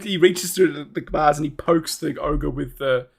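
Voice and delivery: normal talking voice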